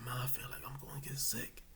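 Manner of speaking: faint whispered plea; sick whisper. Retold or imitated. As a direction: whisper to mom, feeling faint. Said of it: "I said".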